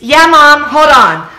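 put-on high, loud teen answer; teen daughter. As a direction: shout back to mom upstairs. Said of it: "I'm gonna be Kara".

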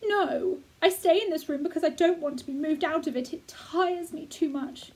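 a hint of a weary invalid; a sick boy explaining why he stays in bed; lightly voiced boy. Colin voiced by reader.

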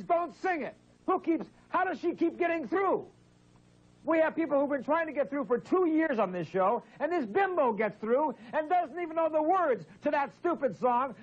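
high-pitched, exasperated tirade; angry rant. Real, not acted. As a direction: rant indignantly at the bungling caller. Host ranting about the singing caller.

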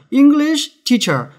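"English teacher" high and emphatic; strong nouns. Shown as a demonstration. Teacher explains nouns are read strongly, emphatic stress. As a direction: hit both "English" and "teacher" firmly, slowly. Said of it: "명사니까 강하게 읽는다".

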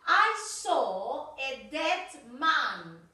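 very slow, each word laid out; slow model sentence. Shown as a demonstration. Teacher modelling an example for students, very slow. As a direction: dictate it slowly for the class. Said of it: "teacher modelling an example sentence".

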